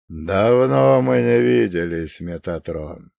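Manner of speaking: put-on slow, low, hoarse old man; hoarse chest voice. A narrator's reading. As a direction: rasp it from the chest, unhurried. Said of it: "грудным, хриплым голосом".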